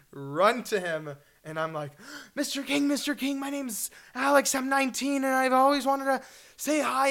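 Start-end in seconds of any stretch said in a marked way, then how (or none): 2.3-7.1 higher, breathless eager greeting; eager fan. Retold or imitated. Starstruck teen meeting Larry King, higher eager voice.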